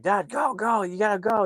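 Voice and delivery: high, urgent pleading; mock panic. Narrator as kids fleeing the truck, mock panicked urging. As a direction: imitate a kid begging Dad to hurry.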